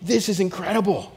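raving to the camp director Jonathan, high and giddy; going crazy. Retold or imitated. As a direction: gush at your friend in disbelief. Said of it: "And I'm going crazy".

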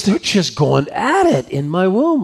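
exasperated mother. Acted. Mom complaining about twins fighting in her womb, mildly exasperated.

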